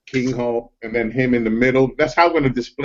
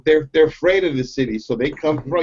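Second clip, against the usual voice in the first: ordinary talk, nothing marked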